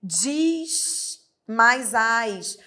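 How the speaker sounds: slow spelling out. Shown as a demonstration. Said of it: "O verbo termina em Z".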